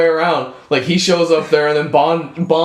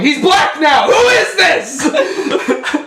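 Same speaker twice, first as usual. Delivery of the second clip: hammed-up disbelief; mock shock; 10 semitones higher, much slower than usual. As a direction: rival agent seeing new Bond, mock shocked shout. Agent shocked by new Bond.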